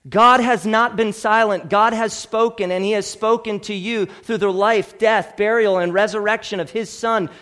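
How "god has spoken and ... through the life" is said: ordinary delivery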